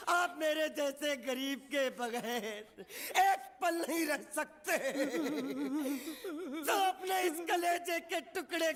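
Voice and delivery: drawn-out, hammed-up wail to the master; melodramatic wailing. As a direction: wail like a heartbroken servant, dragging out the cries. Servant wails at being parted from master.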